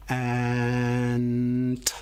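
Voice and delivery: drawn-out slow word; stretched slowly. Shown as a demonstration. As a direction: say it slow like a turtle. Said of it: "say ant real slow like a turtle".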